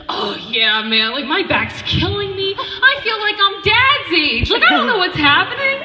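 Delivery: put-on high, loud, giddy kid voice, laughing; high excited little brother voice. Imitating her little brother in hospital, high giddy kid voice. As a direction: play the doped-up cheerful kid. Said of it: "He's like".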